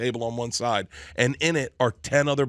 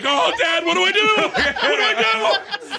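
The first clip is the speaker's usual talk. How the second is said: much higher and louder, laughing, a hammed-up whine; mock panic; 4 dB louder than usual. Son begging his dad for help, in hammed-up high panic. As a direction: squeal it like a scared kid.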